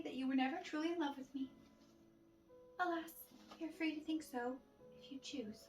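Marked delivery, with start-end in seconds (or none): none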